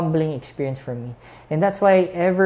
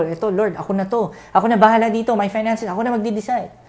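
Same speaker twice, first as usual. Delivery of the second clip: insistent, higher and louder; stubborn self-reliant voice; 9 semitones higher, 4 dB louder, much faster than usual. Retold or imitated. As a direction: claim control like a stubborn believer. Voicing a controlling believer.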